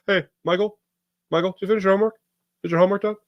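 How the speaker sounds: mock scolding relative; uncle bit voice. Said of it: "That's how my brother and I do a bit".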